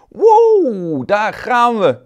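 high, loud whoop of joy; cheerful shout. Acted. Two carrot friends being harvested, whooping high and loud. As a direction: cheer as you're pulled up. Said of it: "roepen de twee vriendjes vrolijk".

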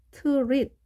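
트릿 as one clipped syllable, no vowel after the t; pronunciation demo. Shown as a demonstration. Teacher isolating 트릿 for the class, clipped. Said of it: "자, 마치".

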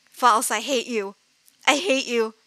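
ordinary delivery

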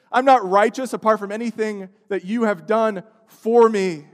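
loud, urgent sermon climax; impassioned preacher pressing the call to repent; preaching at full force. Real, not acted. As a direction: plead with the congregation from the pulpit.

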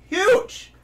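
very high, loud burst on one word; emphatic exclamation. Real, not acted. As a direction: blurt it out in amazement. Exaggerates the men's size.

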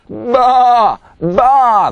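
loud, high excited sheep bleats; excited bleating. A narrator's reading. Amazed sheep admiring Pete's haircut, excited. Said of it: "they exclaimed excitedly".

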